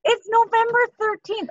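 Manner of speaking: high-pitched, thrilled disbelief; amazed excitement. Real, not acted. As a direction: exclaim it, amazed it's come so early. Amazed by member's early numbers.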